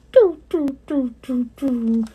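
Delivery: a hammed-up Pac-Man losing sound; mock falling-score jingle. Telling viewers their score sinks, hammed-up doo doos. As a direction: sing the doo doos dropping like a game.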